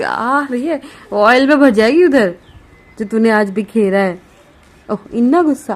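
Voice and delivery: loud, eager coaxing. Real, not acted. Calling baby over.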